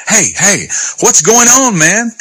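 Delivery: high-pitched acted call-over; puzzled older brother. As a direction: call the servant over, voice jumping up. Older son calls a servant over.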